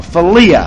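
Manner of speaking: philia said fi-LI-a, stress on the LI; philia, stress on second syllable. Shown as a demonstration. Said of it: "the emphasis on the second syllable".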